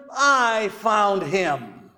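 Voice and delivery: long drawn-out I, then found him falling; stress on I. Retold or imitated. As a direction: stretch I slowly as if taking the credit. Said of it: "the emphasis in that statement is on me".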